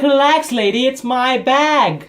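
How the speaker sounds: high, loud fed-up shout; hammed-up outburst at the clerk; exasperated. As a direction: cry out in exasperation, dragging the words.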